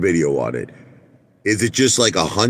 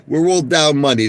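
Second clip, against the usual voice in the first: ordinary talk, nothing marked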